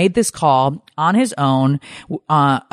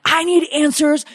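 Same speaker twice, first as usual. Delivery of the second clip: sharply higher, demanding outburst; livid demand; 11 semitones higher than usual. Retold or imitated. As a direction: demand answers, pitch jumping up. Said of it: "And I am livid".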